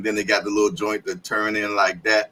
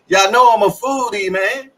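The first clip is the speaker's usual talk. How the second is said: excited shout; 11 semitones higher, 8 dB louder than usual. Real, not acted. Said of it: "repeated line".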